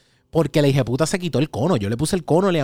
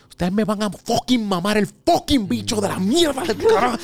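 quiet rage; 6 semitones higher than usual. Retold or imitated. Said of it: "esto era todo así bajito".

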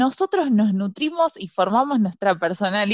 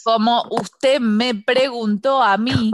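slow, drawn-out insistence; raised tone; slower than usual. Acted. Student repeating herself to the professor, slow raised tone. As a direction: hold every word until you get the floor. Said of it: "me baja el tono".

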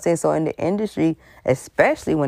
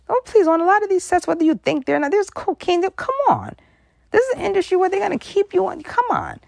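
much higher, incredulous scoffing; exasperated rant. Real, not acted. Vlogger ranting about cocaine on sets, high exasperated. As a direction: scoff at the denial.

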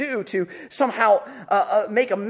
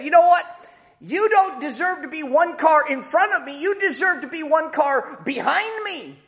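a touch of gloating driver; mock road rage; 6 semitones higher than usual. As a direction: tell off the other car, higher. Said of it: "and I said".

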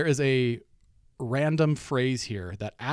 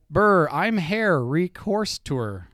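loud, slow, syllable by syllable, higher; slow sounding out; 6 semitones higher, 8 dB louder, much slower than usual. Acted. Host sounding out a game card for his wife, loud and slow. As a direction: read each chunk out slowly and loudly like a puzzle.

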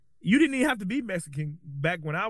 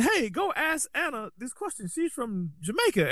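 higher, lightly voiced Jamaican coworker; imitating coworker; 7 semitones higher than usual. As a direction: mimic her casual pointer, pitched up. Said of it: "And she said".

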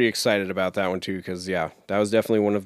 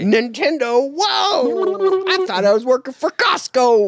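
squeaky, startled doofus; high goofy voice; 12 semitones higher, slower than usual. Retold or imitated. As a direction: yelp the whoa way up high. Voicing the clueless presenter.

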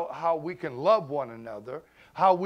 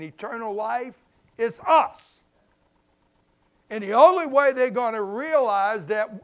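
louder, higher, driving sermon cadence; preaching at full force; 5 semitones higher, 6 dB louder than usual. Acted. Pastor to congregation, preaching at full force. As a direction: build it like a pulpit climax.

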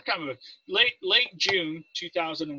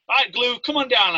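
calling out; 6 semitones higher, 4 dB louder than usual. Retold or imitated. Officer summons recruit to front.

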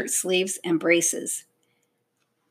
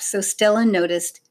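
ordinary delivery

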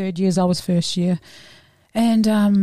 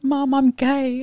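slow, higher-pitched, upset admission; retold tearful confession; 6 semitones higher, much slower than usual. Daughter coming out to mum at lunch, tearful. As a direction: relive a tearful coming-out, without sobbing. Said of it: "And I was crying".

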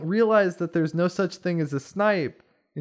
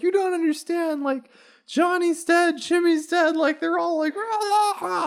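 put-on very high, squealing panicked kid; hammed-up hysterical wail, breaking into babble; freaking out; 14 semitones higher than usual. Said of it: "like, freaking out".

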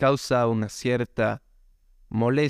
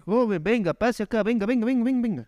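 hyped fairground barker; 11 semitones higher than usual. Acted. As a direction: shout your wares at passing shoppers. Market vendor hawking to customer.

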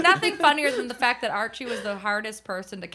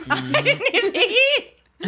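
high, slow mock New Zealand accent, laughing; 11 semitones higher, 5 dB louder, much slower than usual. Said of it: "how to do a New Zealand".

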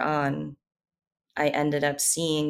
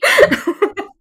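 tearful laugh; 13 dB louder than usual. Real, not acted. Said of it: "I'm trying not to cry".

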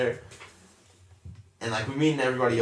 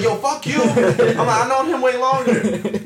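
higher, louder jokey shout; mock yell; 10 semitones higher, 6 dB louder, slower than usual. Teasing his girlfriend on the climb, loud and high. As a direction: yell it at her, half laughing.

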